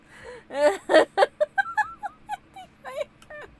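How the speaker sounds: high, squealing wheeze of laughter; helpless high-pitched laughing fit; tears of laughter; crying laughter. Real, not acted. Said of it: "I'm crying".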